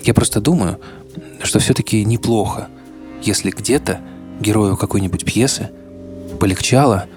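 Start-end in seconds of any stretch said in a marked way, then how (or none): none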